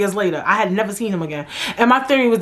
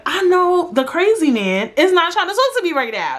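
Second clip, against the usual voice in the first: retold annoyance; 7 semitones higher than usual. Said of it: "I was pissed".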